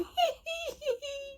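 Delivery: squealing giggle; high laughing squeal; high squeal of laughter. Real, not acted. More laughter.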